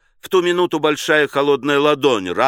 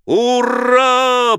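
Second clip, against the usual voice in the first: high, loud, long-held hurrah; drawn-out victorious yell; triumphant cheer; 11 semitones higher, 6 dB louder than usual. A narrator's reading. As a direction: shout it in victory. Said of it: "победно закричали".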